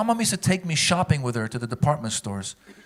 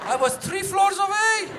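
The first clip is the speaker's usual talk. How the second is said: put-on high, slow Persian-accented mom shouting; mom's accent, furious high yell; Iranian mom yelling; 13 semitones higher than usual. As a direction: scold like an angry immigrant mom, pitched way up. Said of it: "my mom had an accent in that joke".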